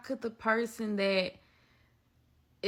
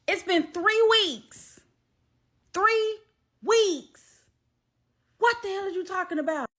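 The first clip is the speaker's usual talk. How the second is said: high, loud reenacted protest; outraged; 11 semitones higher, 5 dB louder than usual. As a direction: hammer it out in high-pitched disbelief. Retold outrage at three weeks.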